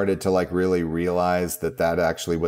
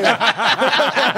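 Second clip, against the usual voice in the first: burst of laughter; 7 dB louder than usual. Real, not acted.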